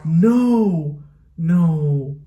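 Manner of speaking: loud, high cries of no; anguished denial. Real, not acted. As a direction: shout no at the screen. Character dying on screen.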